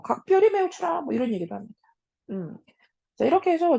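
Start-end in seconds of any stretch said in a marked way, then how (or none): 0.0-1.0 high barked order to flog; commanding shout. Acted. Official shouting the flogging order to his runner. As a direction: cry the order out, sharp and high. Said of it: "사령에게 호령하면서".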